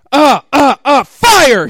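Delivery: high, loud hammed-up scream; mock scream. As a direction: yell it like a cartoon hero climaxing. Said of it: "he screamed".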